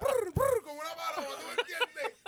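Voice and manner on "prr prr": high rolled prr sound; bird trill. Retold or imitated. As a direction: trill like a pigeon. Imitating a bird noise.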